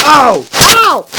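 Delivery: loud high pained yelps; loud real ouch cries. Real, not acted. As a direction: yelp from a sudden bump. Kid hit himself.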